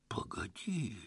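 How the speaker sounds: voiceless, stunned whisper; hushed, breath-only shock; stunned interjection. A narrator's reading. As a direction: whisper it, dumbfounded. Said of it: "ошеломлённо перебил Лёха".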